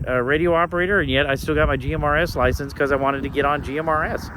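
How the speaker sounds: normal talking voice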